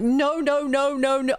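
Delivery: high, panicky string of nos, acted out; terrified refusal, retold. Begging a friend not to introduce them, mock-terrified. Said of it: "I was terrified".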